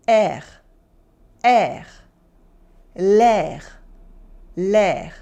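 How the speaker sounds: "R" rasped at the back of the throat; harsh French R. Shown as a demonstration. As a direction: scrape the R in "R" and "l'air", throaty French. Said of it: "It's much harsher than the English R".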